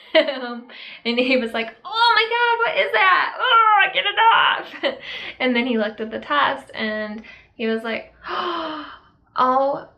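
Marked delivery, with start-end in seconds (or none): 1.8-5.0 high, loud, squealing panic, as if pee hit him; panicked shriek. Retold or imitated. Wife imitates husband splashed with pee, high panicked shriek. 8.3-9.9 surprised gasp. Retold or imitated. Husband sees positive test.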